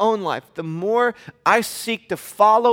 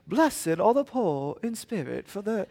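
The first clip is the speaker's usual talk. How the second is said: put-on British accent; 2 semitones higher, 6 dB quieter than usual. Preacher mocking movie Jesus, British accent. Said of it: "British accent".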